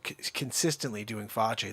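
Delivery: normal talking voice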